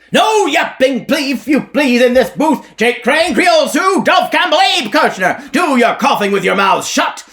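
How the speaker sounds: high, loud evangelist hollering at the crowd; revivalist preacher. Acted. Elijah harangues the whorehouse crowd like a preacher. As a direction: bark like a tent-show preacher selling salvation. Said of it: "Elijah's voice, harsh as a corn crake's".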